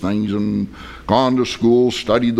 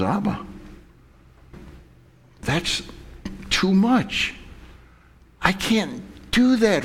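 put-on high, soft, halting child's protest; pleading son; 7 semitones higher, 6 dB quieter than usual. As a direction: protest to Dad in a small high voice. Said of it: "the son looks up at his father".

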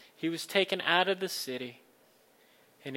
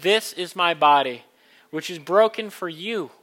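liturgical reading; 8 dB louder than usual. Acted. Words of institution.

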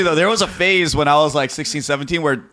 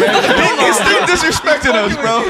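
laughing; 13 semitones higher, 4 dB louder, slower than usual. Real, not acted. Mock outrage while laughing.

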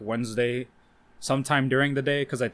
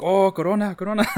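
mock coworker teasing; 7 semitones higher, 4 dB louder than usual. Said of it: "be like".

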